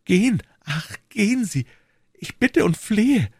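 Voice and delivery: high-pitched, slowing entreaty; imploring. Acted. Queen entreating the duke to go, imploring.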